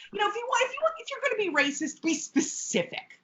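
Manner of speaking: high, fast mock advice; high mock Jessie. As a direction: squeak it out like a smug bigot. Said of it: "She's like the".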